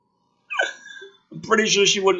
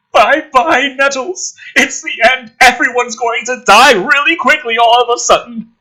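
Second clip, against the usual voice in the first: mock doom; 11 semitones higher, 8 dB louder than usual. Mocking doom over the scene.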